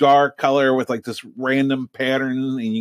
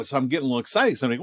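usual speaking voice

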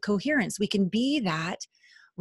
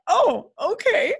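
surprised okay; 11 semitones higher, slower than usual. Retold or imitated. Her reaction to mentor.

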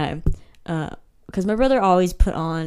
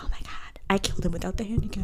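hushed, quick whisper of disbelief; awed whisper; 7 dB quieter, faster than usual. Retold or imitated. Player recalling killing her brother without the handicap, awed whisper. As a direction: whisper it like a stunned secret. Retold amazed reaction to a kill.

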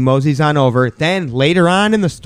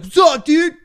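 squeaky bro voice. Retold or imitated. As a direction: squeak it out like a nervous dude. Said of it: "I was like".

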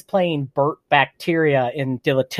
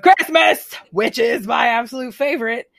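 excited; 8 semitones higher than usual. Real, not acted. Gushing about Christmas.

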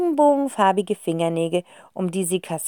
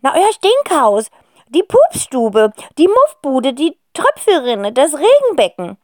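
impatient alien; 7 semitones higher, 10 dB louder than usual. Acted. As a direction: list the names with impatience. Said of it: "erklärt das Alien und kräuselt ungeduldig". (hohe, laute Alienstimme, ungeduldig)

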